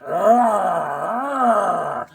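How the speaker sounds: drawn-out mock undead howl; risen-corpse wail. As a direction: wail like something climbing from a grave. Voices man coming out of grave.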